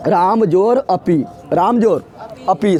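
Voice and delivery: slowly, word by word, राम जोर अपी; slow spelled-out example. Shown as a demonstration. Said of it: "तो हो जाएगा".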